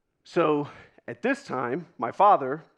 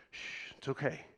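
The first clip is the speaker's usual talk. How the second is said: hushed soothing; 13 dB quieter than usual. Acted. Nine-year-old comforting his crying baby sister, hushed. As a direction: gently hush a crying baby.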